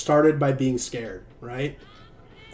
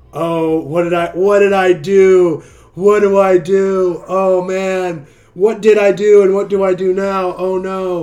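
louder, higher, slow hammed-up panic; panicked sinner; 5 semitones higher, 8 dB louder than usual. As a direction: wail like a sinner who realizes his mistake. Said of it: "Like, fear sets in".

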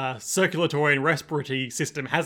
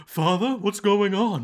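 worried guard; 5 semitones higher, 4 dB louder, slower than usual. Acted. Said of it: "And he's like".

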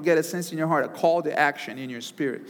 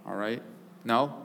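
usual speaking voice

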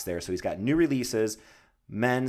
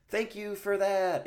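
sarcastic; 12 semitones higher, slower than usual. Real, not acted. Sarcastic thanks to noisy builders.

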